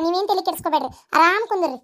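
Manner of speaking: ordinary delivery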